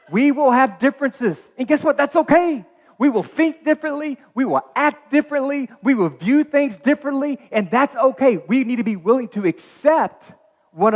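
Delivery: high, loud preaching build; fervent preaching. Acted. Pastor urging his small groups to accept their differences, preaching hard. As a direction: lift the congregation, pushing each line up.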